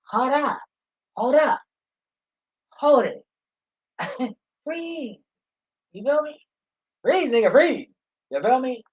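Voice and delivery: high-pitched, loud commands to halt; high stern call. Acted. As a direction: yell stop like a cop. Calling out to stop kids.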